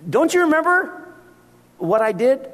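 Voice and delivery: exasperated Jesus. Retold or imitated. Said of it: "And Jesus says".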